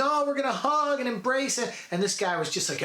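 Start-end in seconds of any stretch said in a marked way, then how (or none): 0.0-1.7 lightly sent-up sentimental reunion; mock happy reunion. Imagining dad welcoming his daughter, mock gushing. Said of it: "gonna be one of these".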